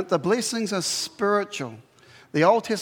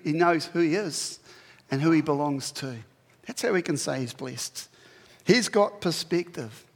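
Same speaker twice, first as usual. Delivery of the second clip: usual speaking voice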